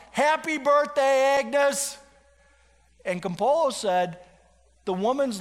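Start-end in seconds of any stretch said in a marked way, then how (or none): 0.1-2.0 high, loud, drawn-out celebratory shout; imitated crowd shout. Whole diner surprising Agnes on her birthday, shouting. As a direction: call it out slowly like a whole crowd. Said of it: "everybody shouts".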